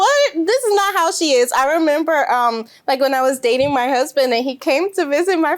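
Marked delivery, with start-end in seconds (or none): 0.0-1.5 mock family disbelief. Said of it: "they're just like".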